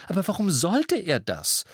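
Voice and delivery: high anxious mother's question; fretting mother's question; worried question. Acted. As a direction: ask it high and fretful. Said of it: "fragte Misses Weasley".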